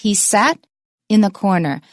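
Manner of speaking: weight on "sat", short pause, light "in"; corrected model with "in" unstressed before "corner"; no stress on "in". Shown as a demonstration. Teacher correcting the wrong version, unstressed "in".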